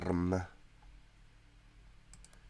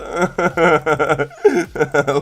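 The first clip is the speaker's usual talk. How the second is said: higher, louder burst of laughter; 8 semitones higher, 10 dB louder than usual. Real, not acted. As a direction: laugh hard at your own story. Laughing at own story.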